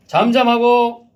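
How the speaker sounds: slow, loud, high rebuke; stern command. Acted. Jesus rebuking the demon, slow stern command. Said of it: "그리고 말씀하십니다".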